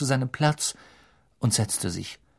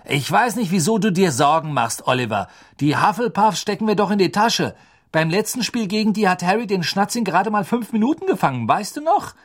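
higher, louder breezy teammate; cocky reassurance; 8 semitones higher, 4 dB louder than usual. A narrator's reading. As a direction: wave the worry away. Fred reassuring Wood.

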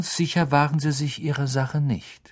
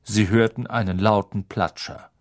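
usual speaking voice

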